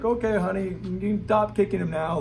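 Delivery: a hint of a calm soccer dad calling his daughter; mellow dad. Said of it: "like a normal dad".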